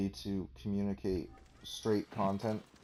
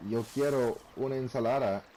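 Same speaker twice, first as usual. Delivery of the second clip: a hint of a formal Spanish accent, slightly raised; rolled, over-careful Spanish order; Spanish said a touch too properly; overdone Spanish; 4 semitones higher than usual. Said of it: "you wouldn't wanna necessarily wanna say".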